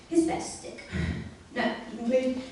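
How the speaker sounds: ordinary delivery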